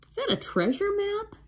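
put-on high curious boy; young boy voice. A narrator's reading. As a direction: ask like an eager boy. Jack voiced in story.

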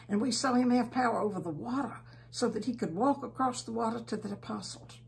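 ordinary delivery

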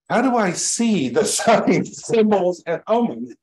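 eagerly ask, half laughing at yourself; a laughing, excited retold question. Retells his own eager question.

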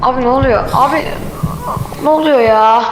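loud alarmed "what's happening"; panicked. Real, not acted. Panicked by a monster.

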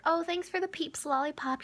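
high, syrupy innocent voice; mock sweet thanks. Thanking dad for the lollipop, high mock-innocent. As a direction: play the angel, high and sweet.

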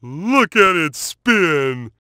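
ordinary talk

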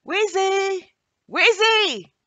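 very high, loud repeated call. Acted. Anna calling loudly for the missing biscuit in the kitchen. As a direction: shout her name into the empty kitchen. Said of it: "called out for her".

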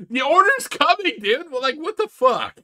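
high-pitched, loud exasperation; exasperated protest. Real, not acted. Commentator telling the customer his order is coming, exasperated protest. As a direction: protest it, voice jumping right up. Arguing back at the clip.

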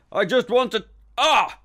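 high, loud plea breaking into a yelp; cut off by pain. Acted. Inspector climbing, thigh cramps up, yelping in pain. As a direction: call out, then cry in pain.

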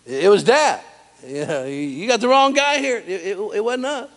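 high, whiny son protesting innocence. Retold or imitated. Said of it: "his sons said".

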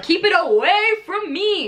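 high, drawn-out mock plea; mock rejection. Friend acting out refusing love, mock horror. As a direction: push it away, high and slow.

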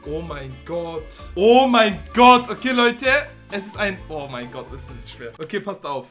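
ordinary talk, nothing marked

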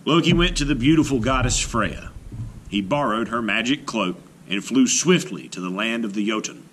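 plain reading voice

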